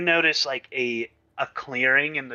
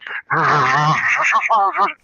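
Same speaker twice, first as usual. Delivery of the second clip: laughing; 2 semitones higher, 8 dB louder than usual. Real, not acted. Laughing at GM's voice description.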